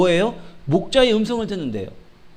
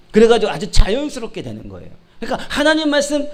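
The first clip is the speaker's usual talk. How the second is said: ordinary delivery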